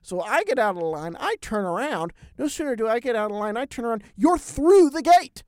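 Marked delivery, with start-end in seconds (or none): 4.2-5.4 loud, high, slow disbelief; can't believe he got through; shocked outburst at the husband sailing through; surprised. Real, not acted.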